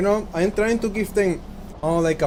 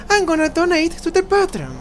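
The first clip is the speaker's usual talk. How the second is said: raised character voice; 12 semitones higher, 5 dB louder than usual. Acted. Voicing a game character.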